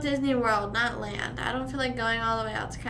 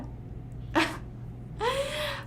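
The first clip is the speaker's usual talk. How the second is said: high nervous laugh; 14 semitones higher, 8 dB quieter than usual. Real, not acted. Laugh after disgust.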